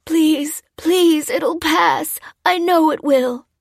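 put-on high, loud, desperate old man; spluttered pleading. A narrator's reading. Old man begging his captors, desperate splutter. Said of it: "spluttered the old man".

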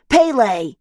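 loud called-out name; a child yelling an answer. Acted. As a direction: shout the name across the room. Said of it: "yells Oscar".

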